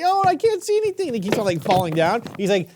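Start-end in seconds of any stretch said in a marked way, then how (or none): 0.0-1.1 high frightened whine, mock scared; scared people. Uncle voicing scared Egyptians for Georgie. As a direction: squeak it like a panicked Egyptian.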